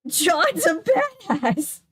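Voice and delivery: slow, high admiring cheer; excited cheer. Real, not acted. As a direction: cheer him on. Cheering the character.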